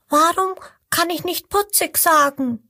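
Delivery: high, puzzled little voice; a small one asking innocently; little crocodile asking. Acted. Little crocodile questions the big ones, high curious voice. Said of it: "fragt das kleine Krokodil".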